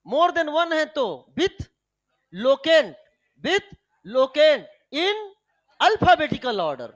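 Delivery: dictated slowly and loudly, word by word; slow dictation. Shown as a demonstration. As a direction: spell out the rule slowly for students to write down. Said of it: "Is it clear?".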